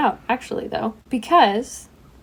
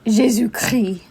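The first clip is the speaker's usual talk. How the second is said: said in breathy French; quick French exclamation, whispery French accent; slower than usual. Pretend. Host tossing in a favorite French phrase, French accent. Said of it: "My favorite thing to say in French".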